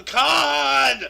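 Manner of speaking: hammed-up roar, drawing out the name; mock dramatic yell. Host riffs on film title My Name Is Khan, hammed-up yell.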